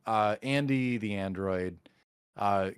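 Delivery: usual speaking voice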